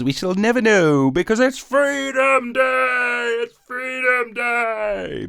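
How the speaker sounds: drawn-out mock triumphant call; mock patriotic cheer. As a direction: crow it like a rally cry.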